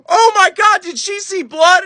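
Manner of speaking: high, loud mock panic; panicked friend. Friend finding her companion passed out, panicked. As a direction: cry it out high, imitating a frantic friend.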